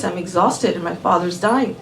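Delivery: plain reading voice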